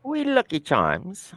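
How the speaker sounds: high, slow, soft Irish leprechaun voice; hammed-up Irish, pitched high and quiet; mock Irish accent. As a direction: draw it out in comic Irish. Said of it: "I like Irish accents".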